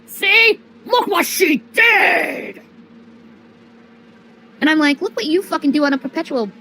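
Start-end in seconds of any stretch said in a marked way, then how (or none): 0.0-2.6 mock shout. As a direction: yell it like a tattling hater. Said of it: "being like".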